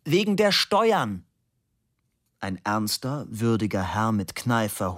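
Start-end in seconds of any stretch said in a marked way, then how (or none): 0.0-1.2 slow, high-pitched yell; drawn-out high shout; bellowing. A narrator's reading. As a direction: bellow the explanation slowly. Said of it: "brüllte Fabian".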